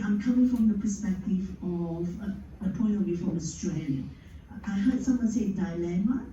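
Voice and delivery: ordinary delivery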